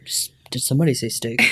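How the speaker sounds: low joking steak line; deep, slow voice. Real, not acted. Cohost joking in a low mock-ad voice. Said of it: "so deep".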